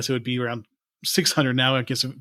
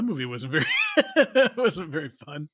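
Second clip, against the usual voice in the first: laughing; 2 semitones higher, slower than usual. Retold or imitated. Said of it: "we were both like".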